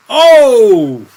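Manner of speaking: very loud, high falling "oh"; excited whoop. Real, not acted. Gamer reacting to a lucky hit, excited yell. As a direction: burst out with a big whoop.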